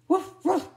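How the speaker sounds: loud, high imitated woofs; storybook dog barking. A narrator's reading. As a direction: bark out an alarm. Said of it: "she barked".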